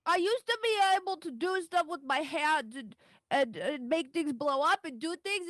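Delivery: put-on high, sulky little girl confessing; sulky child voice. Player voices child Daisy.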